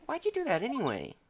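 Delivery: put-on high girlish voice; earnest young assistant asking; lifted pitch, teen girl; Maya voice. Voicing Maya in game dialogue.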